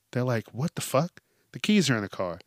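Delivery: ordinary talk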